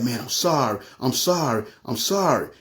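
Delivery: subdued, repeated apology with falling endings; tearful apology. Retold or imitated. Crying robber apologizing to storyteller, mildly tearful. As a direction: imitate a broken man's apology, understated. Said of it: "he just started crying like a baby".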